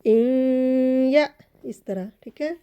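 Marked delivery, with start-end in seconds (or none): 0.1-1.3 इन या hummed through the nose, then a small jolt; slow tajweed recitation; nasal with a jolt. Shown as a demonstration. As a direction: chant it slowly with a slight push. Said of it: "थोड़ा सा हमने झटके से इसे पढ़ना है".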